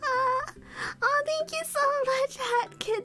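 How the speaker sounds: high, delighted thank-you; gushing gratitude to the viewer; high, happy thanks; excited thanks. Real, not acted. Thanking a donor.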